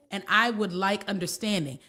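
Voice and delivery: usual speaking voice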